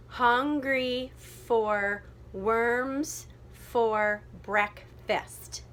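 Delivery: very slow, every syllable apart; checking syllables. Shown as a demonstration. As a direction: lay out each beat with gaps. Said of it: "double check the syllable".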